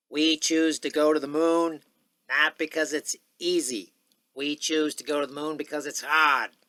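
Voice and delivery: rally a crowd in a stadium; stirring oratory, words held out; Kennedy impression. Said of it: "Okay, here's Kennedy".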